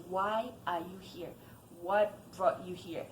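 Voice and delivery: ordinary talk, nothing marked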